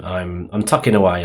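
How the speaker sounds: normal talking voice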